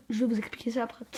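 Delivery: ordinary talk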